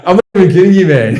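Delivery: laughing retort. Retold or imitated. Quoting himself to the tattoo caller.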